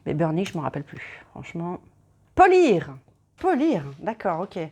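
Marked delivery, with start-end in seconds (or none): 2.3-3.0 sudden realisation. Real, not acted. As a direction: blurt it out as the answer clicks. Finds the meaning of burnish.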